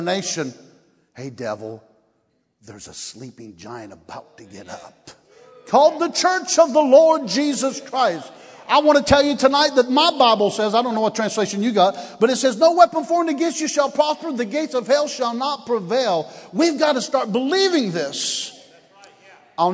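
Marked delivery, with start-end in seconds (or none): 1.1-5.1 very quiet, low warning to the devil. Real, not acted. 5.7-18.6 loud, high-pitched proclamation; full-force preaching. Real, not acted.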